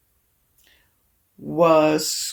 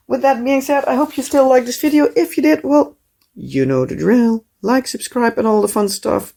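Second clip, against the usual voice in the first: cheerful outro; 5 semitones higher, 4 dB louder than usual. Acted. Upbeat video outro.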